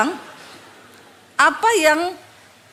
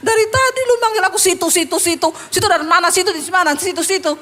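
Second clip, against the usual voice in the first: high, rapid-fire annoyed retort; exasperated inner rant, played for laughs; mock indignation; 4 semitones higher, 3 dB louder, faster than usual. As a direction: bristle comically at being addressed rudely. Pastor's irritated inner retort, acted out.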